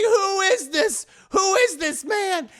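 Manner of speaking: high, loud, drawn-out shout; hammed-up outraged husband; acted angry demand. Husband confronts wife about the stranger, mock outrage.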